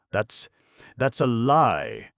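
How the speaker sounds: loud, slow outraged shout; angry cry. A narrator's reading. Brawler accusing across the table, shouting. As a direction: bellow the accusation. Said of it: "he cried in a new voice".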